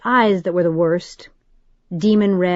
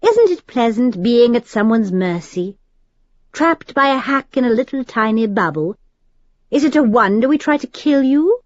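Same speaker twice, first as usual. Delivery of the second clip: higher, bitter upper-class British taunt; cocky British aristocrat, raised; noble British accent; 5 semitones higher than usual. A narrator's reading. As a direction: gloat in a precise British accent. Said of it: "his noble British accent perfect and precise".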